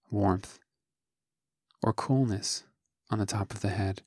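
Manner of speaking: soft, unhurried meditation voice with long pauses; calm, hushed instruction, phrase by phrase; guided meditation. Acted. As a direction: guide listeners gently through a body scan, leaving space after each phrase. Leads a guided body scan.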